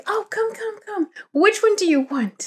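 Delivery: high, soft eager coaxing; imitating aunt beckoning. Aunt calling her niece over to the lip gloss, high and coaxing.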